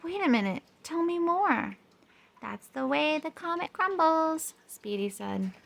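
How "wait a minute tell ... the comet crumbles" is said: high, quick rocket voice; chirpy space rocket, up high. A narrator's reading.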